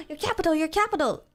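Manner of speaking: high gasping disbelief; shocked gasp. Real, not acted. Gasping at chat's capital.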